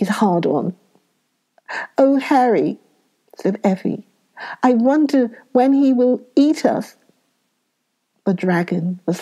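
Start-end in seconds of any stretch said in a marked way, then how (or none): 2.0-2.8 frightened. A narrator's reading. Girl caught by dragon. 4.6-7.0 frightened. A narrator's reading. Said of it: "said Effie, in dragon's claws".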